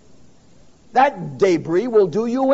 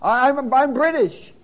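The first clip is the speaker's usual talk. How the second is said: a lightly voiced hearer, pitched higher, making an excuse; mock excuse; 6 semitones higher than usual. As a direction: play the churchgoer making excuses, half joking. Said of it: "You say".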